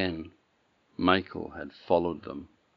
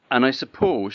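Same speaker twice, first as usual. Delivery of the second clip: haughty; 6 semitones higher, 11 dB louder than usual. Acted. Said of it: "in a rather a haughty voice".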